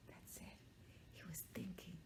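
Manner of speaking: hushed unvoiced whisper. A narrator's reading. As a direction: lean in and whisper it. Storyteller drops to a whisper.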